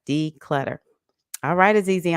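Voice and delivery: usual speaking voice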